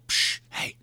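soft hissed shush; shushing whisper. Shown as a demonstration. Showing how to shush a noisy moviegoer. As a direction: shush them gently under your breath. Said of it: "Just give it a little".

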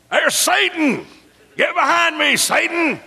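very high mock-panicked cry; hammed-up alarm. Acts out reaction to lizard commercial.

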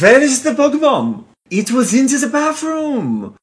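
put-on high, loud hammy German accent; bad German accent. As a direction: ham up a terrible German accent. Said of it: "it's that level of German accent".

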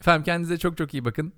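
ordinary talk

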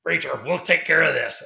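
mock tough-guy promise; tough bodyguard voice. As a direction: imitate the armed usher stepping in, bold and loud. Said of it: "the one guy talks like this".

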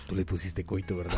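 low, soft mock father; a quiet knowing dad voice; imitating dad. Dad turns to son about the name, low and hushed. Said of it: "así me voltea y me dice".

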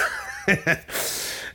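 quick unvoiced laugh at his own joke; soft chuckle after the punchline; breathy laugh. Real, not acted. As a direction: let out a short airy laugh.

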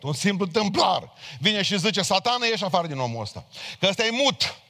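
loud, high, impassioned sermon delivery; preaching at full force. Acted. Preacher proclaiming the gospel to the congregation, loud and impassioned. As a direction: preach it out to the whole church.

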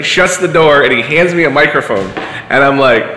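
usual speaking voice

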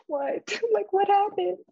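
startled disbelief. Retold or imitated. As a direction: jolt up in shock and ask. Candle blew out, startled.

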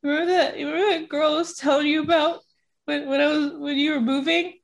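high, halting, pleading voice; retold upset. Telling mom about the girlfriend, retold upset and emotional voice. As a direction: imitate an emotional explanation, pausing and restarting. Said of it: "I'm so upset and emotional".

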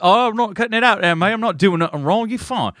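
mock Cockney, playing innocent; Cockney accent. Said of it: "doing a Cockney accent".

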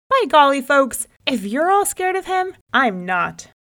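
high, loud bragging exclamation; bold boast. Acted. Rabbit boasting loudly to the scared folks. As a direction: puff up and crow it out.

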